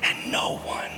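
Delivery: tender unvoiced whisper of God. God speaking to his child, tender whisper. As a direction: murmur it close to the ear. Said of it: "God whispers because he's close".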